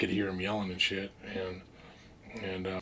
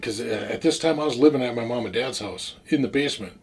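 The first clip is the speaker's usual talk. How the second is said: ordinary talk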